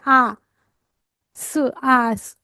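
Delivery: आ, स, आस sounded out very slowly, each sound held apart; slow sound blending. Shown as a demonstration. Teacher to young students, blending sounds into आस slowly. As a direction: stretch आ and स, then join them into आस. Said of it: "एडबलएस का आस है".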